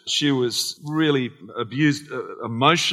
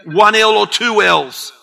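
raised, loud playful question; loud joking call; 6 semitones higher, 11 dB louder than usual. Real, not acted. Pastor teasing a congregant named Philip, loud and playful. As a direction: call it out across the room.

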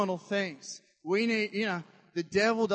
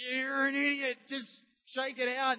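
higher, impatient mock scolding; exasperated jab at gloomy Christians; exasperated retort; 7 semitones higher than usual. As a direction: snap them out of it. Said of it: "it's like".

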